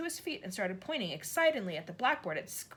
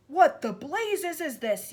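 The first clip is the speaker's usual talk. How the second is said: slow, higher, louder outraged yell; 9 semitones higher, 10 dB louder, slower than usual. A narrator's reading. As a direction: shaken but furious. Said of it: "yelled the Trunchbull".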